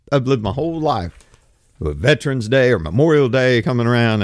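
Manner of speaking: usual speaking voice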